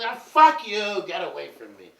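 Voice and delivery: hammed-up brush-off from a lover; mock rejection. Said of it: "she goes".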